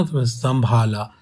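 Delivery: slow "संभाला" with the half म clearly sounded; sam-bhaa-laa said slowly, the nasal held. Shown as a demonstration. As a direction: draw out "संभाला" so the half म rings. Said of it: "आधा मैं भी pronounce हो रहा है".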